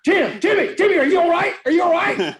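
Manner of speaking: high, loud, rapid-fire check; regular-speed urgent calling. Retold or imitated. Teammate calling to a knocked-out player, high and urgent. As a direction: call out urgently to rouse him. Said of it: "that's what the regular speed was".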